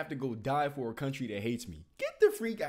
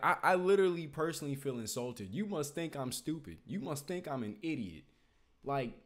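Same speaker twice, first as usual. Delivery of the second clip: normal talking voice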